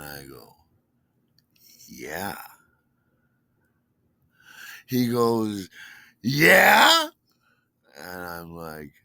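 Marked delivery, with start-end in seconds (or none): 1.9-2.4 low, soft, almost whispered answer; subdued reply. Retold or imitated. His own subdued answer, retold. 6.2-7.1 much louder, high rising query; retold challenging question. Boss presses him, retold exchange.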